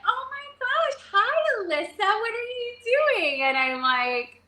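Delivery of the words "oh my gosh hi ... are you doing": mom. Retold or imitated. Mom walks in on her daughter, high mock surprise. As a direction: imitate mom's high, surprised greeting. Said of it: "my mom comes walking in".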